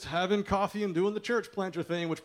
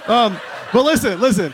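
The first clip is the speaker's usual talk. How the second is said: loud, raised voice over laughter; calling for attention; 6 semitones higher, 12 dB louder than usual. Real, not acted. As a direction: cut through the laughing crowd, loud and high. Talking over the crowd's laughter.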